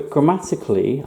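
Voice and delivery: normal talking voice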